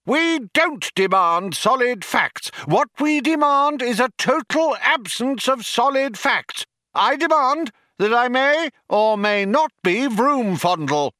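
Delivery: high-pitched, strident tirade; breathless shouting. A narrator's reading. Younger philosopher reverses his demands, unbroken indignant shouting. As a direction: shout on scarcely pausing for breath. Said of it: "Scarcely pausing for breath, Vroom Fondle shouted".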